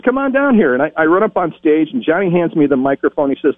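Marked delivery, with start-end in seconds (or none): 0.0-0.7 high, playful announcer challenge; teasing announcer. Retold or imitated. Johnny Olson daring the eager teen, high playful announcer. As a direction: dare the kid with a grin in the voice.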